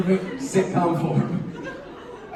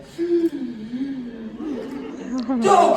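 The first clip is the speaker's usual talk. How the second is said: relieved gasp; 7 semitones higher than usual. Retold or imitated. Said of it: "I was like".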